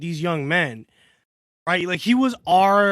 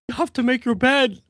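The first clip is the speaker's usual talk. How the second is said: put-on second failed go at a Canadian accent, pitched up; attempted Canadian accent; 5 semitones higher than usual. Retrying Peterson's bed advice for a friend, badly faked Canadian accent. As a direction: have a poor stab at sounding Canadian, higher voice. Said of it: "I, I can't do it".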